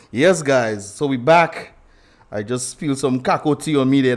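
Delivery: ordinary talk, nothing marked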